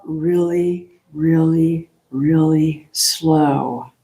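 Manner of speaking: slow, drawn-out words like a long breath out; slow exhale demonstration. Shown as a demonstration. Teacher showing the group a long exhale, drawn out slowly. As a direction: let each word trail out slowly.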